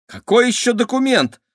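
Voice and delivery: high, loud startled question; exclaiming. A narrator's reading. Kira eyes the dynamite, high startled exclaim. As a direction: blurt it out alarmed. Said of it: "воскликнула Кира".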